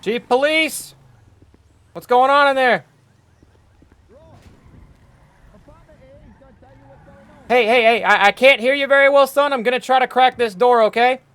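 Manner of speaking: calls out loud and high through a closed door; calling through a door. Acted. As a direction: shout so they hear you through the door. Chief calling through store door.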